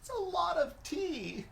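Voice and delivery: very high, squeaked reaction; high-pitched dismay. Real, not acted. Co-host reacting to the tea drink, high squeal of dismay. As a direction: send the voice way up in dismay.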